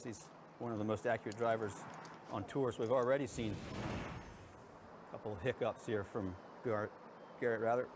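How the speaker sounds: ordinary talk, nothing marked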